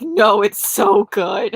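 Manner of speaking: loud, slow, half-sobbed; mock tearful wail. Streamer retelling crying over a book to boyfriend, mock sob. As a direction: stretch it out like a happy crier. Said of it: "I'm like".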